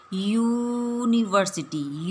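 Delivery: यूनिवर्सिटी drawn out slowly, opening on a yoo sound; slow university. Shown as a demonstration. Teacher slowly models यूनिवर्सिटी with its yoo start. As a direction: stretch यूनिवर्सिटी so the y glide is heard. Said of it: "यूनिवर्सिटी को pronounce करो".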